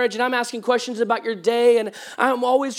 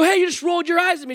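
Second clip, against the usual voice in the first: indignant retort; 8 semitones higher, faster than usual. Retold or imitated. Confronting wife about eye roll.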